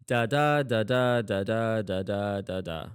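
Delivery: da, da with weak then strong beat; iambic beat. Shown as a demonstration. Teacher showing students the iambic rhythm. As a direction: tap out da, da as a steady iamb. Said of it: "just listen to me here".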